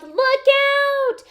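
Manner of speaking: high, loud drawn-out shout; yelled warning. A narrator's reading. Peter spots the cat just in time, high yell. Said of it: "and yelled".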